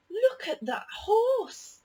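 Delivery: high, slow amazed gasp then look. A narrator's reading. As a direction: marvel at the horse. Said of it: "said Ben".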